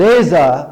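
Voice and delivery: there is a with no r, very slow and loud. Shown as a demonstration. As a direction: drop the r in there is a, slowly. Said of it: "آر کی آواز نہیں نکالتا". (there is a میں آر کے بغیر آہستہ)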